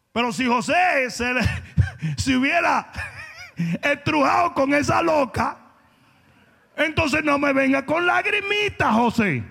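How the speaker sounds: shouted with laughs between; preaching shout. Real, not acted. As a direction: belt it at the congregation, laughing. Predicador gritando con risas. (grito de predicador entre risas)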